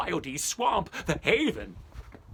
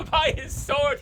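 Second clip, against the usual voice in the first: repeating it through laughter, higher; laughing; 12 semitones higher, 7 dB louder than usual. Real, not acted. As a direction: chuckle the words out, amused.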